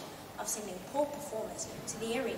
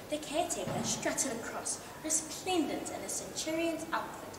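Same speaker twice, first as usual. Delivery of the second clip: ordinary delivery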